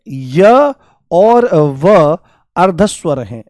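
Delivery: drawn-out slow teacher emphasis; slow, deliberate classroom emphasis; slow for memorising. Shown as a demonstration. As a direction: spell it out slowly so they remember. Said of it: "आपको याद रखना है".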